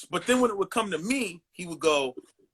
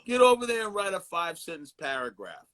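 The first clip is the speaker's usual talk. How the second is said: ordinary talk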